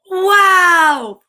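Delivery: loud, high, drawn-out wow; amazed exclamation. Acted. Bo says it of his pup, high amazed wow. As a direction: exclaim in delighted surprise. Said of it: "Bo says".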